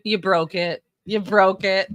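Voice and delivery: ordinary talk, nothing marked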